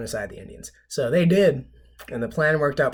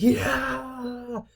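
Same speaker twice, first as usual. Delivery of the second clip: excited crowd yeah; 13 semitones higher than usual. Retold or imitated. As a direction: cheer like a fired-up crowd. Crowd cheering along.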